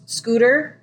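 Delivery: ordinary delivery, nothing marked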